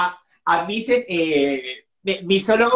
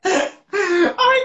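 high cry of laughter; laughing cry; 11 semitones higher than usual. Real, not acted. Host laughing helplessly at guest's answer. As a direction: crack up at his answer.

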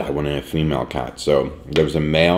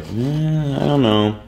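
unsure; 10 semitones higher, slower than usual. Retold or imitated. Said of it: "I was like".

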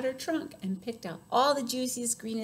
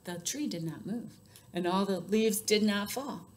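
plain reading voice

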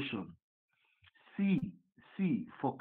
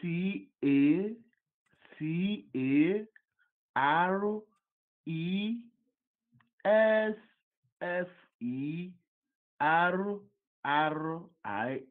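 slow, letter by letter with long gaps; careful dictation with rising letters; slow spelling; 6 semitones higher, much slower than usual. Real, not acted. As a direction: spell it out one letter at a time, lifting each. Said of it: "You're spelling it too fast".

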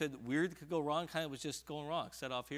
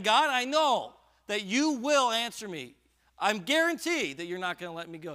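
David's confident plea; 7 semitones higher, 10 dB louder than usual. Acted. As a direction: proclaim it as a sure promise. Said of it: "He is saying".

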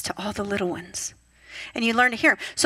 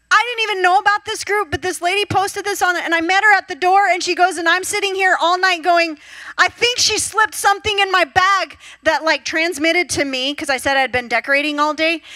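excited; 7 semitones higher, 4 dB louder than usual. Retold or imitated. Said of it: "She was so excited".

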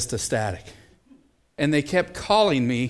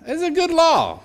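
emphatic high insistence; 13 semitones higher than usual. Real, not acted. Preacher insisting to the congregation that the law is good, high and emphatic. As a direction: hit it high to win the crowd over.

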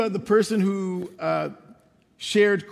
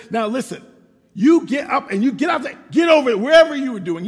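loud, higher stern father yelling; dad's shout; 4 semitones higher, 7 dB louder than usual. Retold or imitated. Father shouting at his crying son to get going. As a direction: bark the order at the crying boy. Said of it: "he stands up and says".

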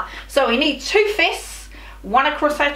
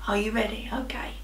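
whisper; 4 semitones lower, 10 dB quieter, faster than usual. Shown as a demonstration. Whispered countdown game with kids.

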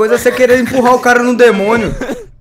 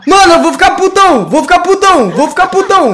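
panicked shout; 4 semitones higher, 4 dB louder than usual. Real, not acted. Chased in horror game.

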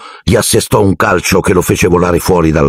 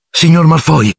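lightly acted breathless call, raised in pitch; panting; 10 semitones higher than usual. A narrator's reading. Harry calls Malfoy after running the corridor, mildly panting. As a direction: call out higher, a touch winded. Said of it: "ansimò".

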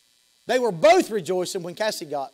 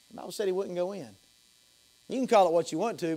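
ordinary talk

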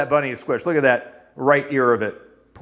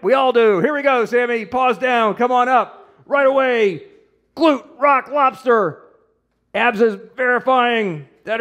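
higher, fast showman hype to the viewers; hype coach; 6 semitones higher than usual. Real, not acted. Therapist hyping viewers for Sammy's next rep, excited. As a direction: sell the next rep with big energy.

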